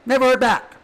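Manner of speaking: Never heard back shouted high and loud; loud bitter outcry. Shown as a demonstration. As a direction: fling the words out in a hurt cry. Repeats line three ways.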